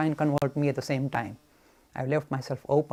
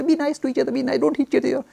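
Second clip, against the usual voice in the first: mock preachy plea; 10 semitones higher, 6 dB louder, faster than usual.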